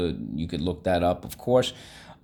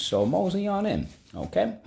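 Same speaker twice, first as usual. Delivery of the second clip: playful invitation; 11 semitones higher than usual. Shown as a demonstration. Inviting class to mosey in.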